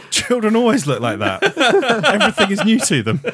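laughing through a quoted line; giggling out a silly quote. Retold or imitated.

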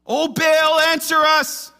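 loud, high desperate call to a god; imitated frantic prayer shout; pleading cry. Prophets crying out to Baal.

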